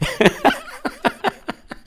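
very high staccato cackle; long, high mocking laugh; eerie cackle. Apparition laughs at him.

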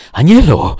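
high, loud alarmed shout; frightened cry. A narrator's reading. Lover scared for his burned beloved, frightened. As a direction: cry her name in alarm. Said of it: "przestraszył się jej ukochany".

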